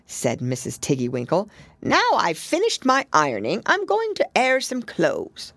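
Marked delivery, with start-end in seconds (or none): 1.8-5.5 hedgehog washerwoman. A narrator's reading. Washerwoman voiced.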